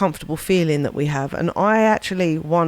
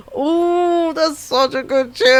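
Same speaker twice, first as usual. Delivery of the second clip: delighted gush; 9 semitones higher, 4 dB louder, slower than usual. Real, not acted. Song starts, DJ gushes.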